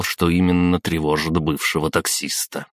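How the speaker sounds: ordinary delivery, nothing marked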